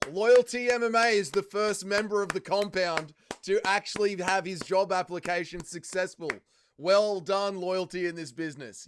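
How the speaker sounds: big booming congratulations to the winner; grand announcement. Acted. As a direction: proclaim it like an awards announcer. Announcing first successful compound job application.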